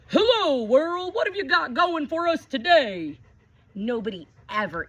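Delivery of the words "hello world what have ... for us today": big, booming hello to the world, much higher and louder; loud greeting. Acted. Poppy greeting the whole world from the window, loud and high. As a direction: call it out loud and high like a cheerful grandpa. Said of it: "say in a real loud voice".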